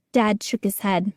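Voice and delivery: usual speaking voice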